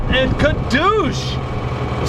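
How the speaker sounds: stretched, high "kadoosh"; slow, high explosion noise; impact sound effect. Shown as a demonstration. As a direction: voice the bullet smash, drawn out. Imitates the bullet hitting.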